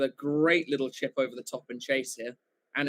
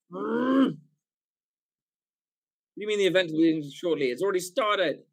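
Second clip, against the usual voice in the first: exasperated disbelief; 5 semitones higher, 6 dB louder than usual. Real, not acted. Stream says event not started.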